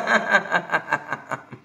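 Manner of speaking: staccato laugh tapering off; laughter exercise. Shown as a demonstration. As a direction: laugh on one breath until it fades. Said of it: "ओम आणि फेका". (विरत जाणारं हास्य)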